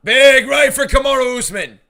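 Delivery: loud, high excited shout. Real, not acted. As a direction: yell it as the punch lands. Big punch lands mid-commentary.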